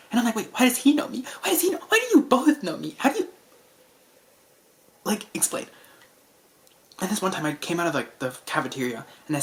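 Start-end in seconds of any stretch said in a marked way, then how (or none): 0.4-3.3 rising higher and higher in disbelief; panicked, climbing questions; bewildered. Retold or imitated. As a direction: voice climbing in confusion, louder. Confused reply to the coworkers.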